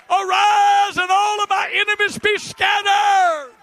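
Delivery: high, loud revival shout; full-force preaching. Real, not acted. Preacher telling congregation to get up, shouted preaching. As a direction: cry it out to rouse the whole church.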